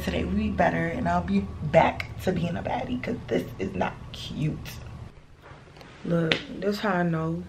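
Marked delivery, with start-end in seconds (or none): 3.0-4.7 soft unvoiced whisper. Real, not acted. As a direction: whisper it under your breath. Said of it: "talk like this".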